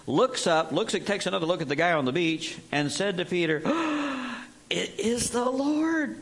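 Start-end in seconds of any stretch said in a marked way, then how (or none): 3.6-4.4 gasp. Acted. Disciple recognizes Jesus. 4.7-6.2 high, slow gasped recognition; like a kid caught with a hand in the cookie jar; caught-out realization. Acted. Disciple tells Peter the man on the beach is Jesus, caught-out awe. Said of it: "I hear this said with the tone of voice kinda like children with their hand caught".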